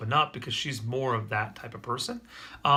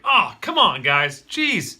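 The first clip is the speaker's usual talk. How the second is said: loud, higher, fed-up groan of a reaction; exasperated outburst; 3 semitones higher, 10 dB louder, much slower than usual. Retold or imitated. Dad replaying his usual reaction to a spilled snack, loud and fed-up. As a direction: imitate your old irritated self. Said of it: "typically I'd be like".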